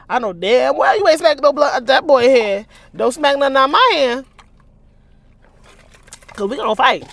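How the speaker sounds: high, loud indignant rant; warning someone off; heated rant. Real, not acted. Reader rants at character.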